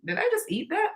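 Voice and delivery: high, hushed gasp of disbelief; quietly appalled at her own snacking; shocked at herself. Retold or imitated. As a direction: catch yourself mid-snack, horrified. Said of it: "that I'm like".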